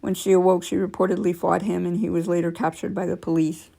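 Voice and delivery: ordinary delivery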